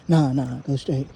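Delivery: low, put-on deep voice giving an order; retold command in a mock deep voice. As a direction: drop your pitch to tell the driver off. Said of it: "I said in my deep voice".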